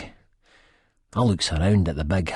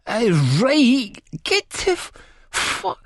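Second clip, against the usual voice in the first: high, slurred broken mumbling; wasted, tailing off unfinished; drugged slur; 3 dB louder than usual. A narrator's reading. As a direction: slur and lose the words halfway. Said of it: "I slur".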